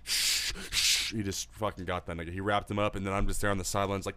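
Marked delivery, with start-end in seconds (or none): 0.0-1.1 two sharp voiceless shushes; whooshing noise. Retold or imitated. Dad grabbing his son, whooshing noise. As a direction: imitate swift moves with shh sounds. Imitated noise of a quick grab.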